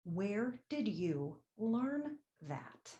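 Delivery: slow soft question. Acted. Repeats question slowly, softly.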